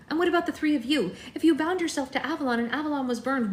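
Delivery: high, fast, eager questioning. Acted. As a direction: rush the question out excitedly. Eager Bella questions the crone.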